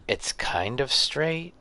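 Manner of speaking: thrilled at the shot; excited. Real, not acted.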